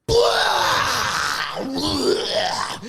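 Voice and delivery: high shrieking alien yell; retold scream like a fucking alien. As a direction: let out a wild, strange shriek. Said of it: "I just screamed".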